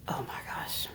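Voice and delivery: breathless whispered exclamation; awed whisper. Real, not acted. Remembering the trip, she whispers in awe. Awed reaction recalling arriving.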